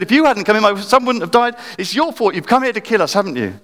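high, indignant accusing voice; accusing woman. Retold or imitated. Grieving mother accusing Elijah, high and indignant. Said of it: "But she said".

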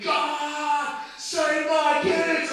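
high, loud, stretched-out cry; imitated screaming woman. Preacher acts a mother praying for her children, screaming. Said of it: "the woman in front was screaming".

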